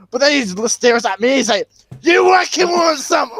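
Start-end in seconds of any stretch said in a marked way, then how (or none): 2.0-3.4 imitated bully's high-pitched yell; mock threatening shout. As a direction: bellow it like a bully picking a fight. Retold bully challenges the storyteller.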